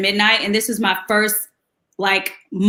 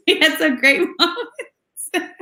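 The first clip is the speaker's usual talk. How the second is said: high, slow words through laughter; laughing; 6 semitones higher, slower than usual. Real, not acted. Singer recalling house party moments, laughing as she talks. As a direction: let giggles break up the words. Laughing over the retold story.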